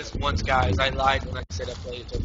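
ordinary talk, nothing marked

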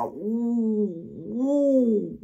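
high, mocking "uh" of fake shock; a hammed-up shocked gasp. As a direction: gasp as if scandalised, tongue in cheek. Mocking fans' shock at draws.

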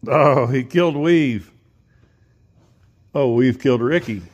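thrilled loud commentary on the scuffle; excited shout. Real, not acted. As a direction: cry out at the coyotes fighting. Excited about coyotes fighting.

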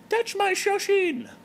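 high falsetto diva voice, loud and pleased; Terry the giraffe. Acted. Terry the giraffe greeting her blog fans in falsetto.

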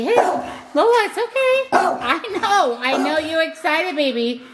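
very high, soothing pet voice; calm the excited dog. Real, not acted. Soothing dog in baby talk.

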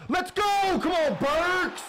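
very high, loud victory yell; elated shout. Real, not acted. Gamer celebrating Burks's catch, elated shout. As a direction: scream for your receiver's big catch.

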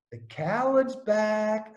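slow, stretched mock heckle; drawn-out taunt. Heckler turning up behind the nets, slow taunting call. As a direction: drag out each word to taunt him.

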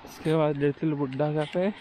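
ordinary talk

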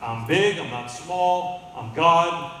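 loud, slow declaration; booming God. Acted. Preacher voicing God to Christians, loud and slow. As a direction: boom it out as God. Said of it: "So he says".

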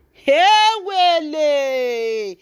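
long, drawn-out high wail; very slow, high gossip's outcry; scandalised exclamation. Real, not acted. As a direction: stretch it out high as the scandal lands. Exclaims at the name revealed.

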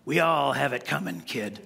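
lightly voiced grim old gunslinger; Clint Eastwood. Pastor quoting the movie's best line to his church, low and grim. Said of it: "He goes".

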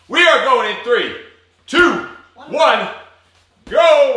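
countdown rising to a loud "go"; building countdown. Real, not acted. Coach counting down to start.

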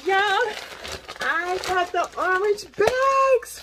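loud, high, slow triumphant announcement; overjoyed. Real, not acted. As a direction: savor the win. Celebrating long-awaited orange bags.

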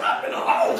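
shocked high squeak while laughing; squeaky disbelief. Retold or imitated. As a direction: gasp it out as a tiny alarmed question. Disbelief at the hole.

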